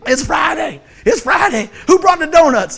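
high, shouted imitation of excited coworkers; cranked-up Friday cheer. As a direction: holler it round the shop, hammed up. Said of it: "they don't get cranked up till Friday".

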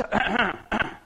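pointed mock throat-clear; imitated throat-clearing. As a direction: clear the throat like someone waiting behind. Man behind interrupts with throat noise.

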